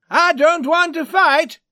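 very high, loud cry; panicked shout. Acted. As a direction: shout it at the top of the voice. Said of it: "he shouted".

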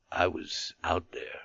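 low, near-whispered words; barely voiced, as if spent; weak murmur. A narrator's reading. Wounded soldier telling of the battle, weakly whispering.

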